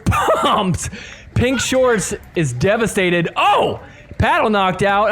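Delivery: ordinary delivery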